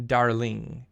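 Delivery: put-on "darling" with a light French accent. Imitating the lover saying darling in a French accent. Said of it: "with your lovely French accent".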